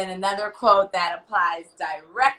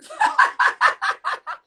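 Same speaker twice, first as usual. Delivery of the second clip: laugh; 4 dB louder than usual. Real, not acted. Laughing at own repeat.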